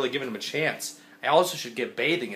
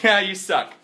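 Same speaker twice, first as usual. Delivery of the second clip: laughing mock insult; 6 semitones higher, 5 dB louder, slower than usual. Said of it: "And she's like".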